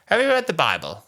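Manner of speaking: high, loud put-on voice; imitated sketch character. Dictating character questions her helper, high imitated voice. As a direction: mimic a comedy character asking, pitched right up. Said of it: "bit in Little Britain where there was the character".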